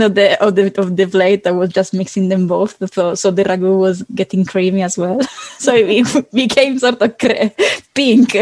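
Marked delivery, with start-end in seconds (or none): 5.6-8.4 laughing. Real, not acted. Laughing over the mixed pasta.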